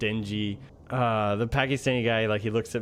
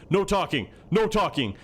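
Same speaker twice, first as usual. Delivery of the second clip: sharp, higher-pitched imitation of a strict cop; strict officer; 7 semitones higher than usual. Police officer to detainees in the back seat, imitated strict. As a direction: order it like a stern police officer. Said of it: "he was so strict".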